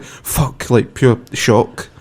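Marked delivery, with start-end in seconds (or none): 0.0-0.7 shocked whisper. Retold or imitated. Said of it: "And I was like".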